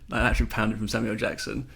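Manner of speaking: ordinary talk, nothing marked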